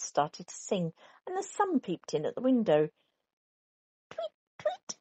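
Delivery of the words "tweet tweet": very high, chirping tweet; birdsong. A narrator's reading. Dawn birds at the car window, chirping very high. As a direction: chirp like a little bird. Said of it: "went the birds".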